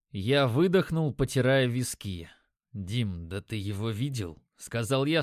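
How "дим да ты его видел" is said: low, soft, hushed question; keeping voice down. A narrator's reading. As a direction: ask it low and quietly. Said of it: "стараясь говорить тихо". (стараясь говорить тихо)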